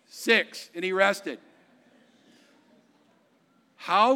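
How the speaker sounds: loud, driving preacher voice; revival-style loud proclamation; full-force preaching. Acted. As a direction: preach it out to the whole room.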